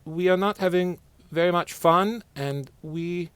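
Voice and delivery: a lightly put-on Norwegian accent. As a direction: Norwegian accent, mild and polite. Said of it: "the Norwegians sorta say".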